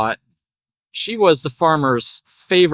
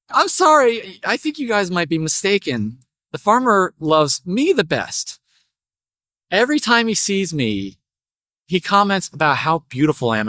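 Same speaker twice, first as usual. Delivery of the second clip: cute little parakeet; 4 semitones higher than usual. Acted. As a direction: pipe up as a small bird. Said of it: "cute little parakeet".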